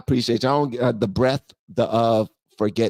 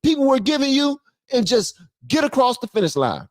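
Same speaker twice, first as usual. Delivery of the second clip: angry scolding; 5 semitones higher than usual. Acted. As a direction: tear into her for losing the race. Scolding Kamala over lost race.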